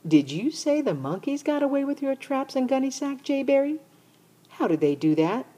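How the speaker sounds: curious little sister, high and eager. Acted. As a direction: pipe up like a nosy little sister. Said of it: "She popped up and said".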